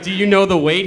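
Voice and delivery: loud mock-innocent question; loud teasing question.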